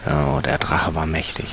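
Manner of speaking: a hushed whisper of awe; awed whisper. A narrator's reading. Narrator whispering in awe of the teacher. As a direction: whisper it as if the dragon might hear.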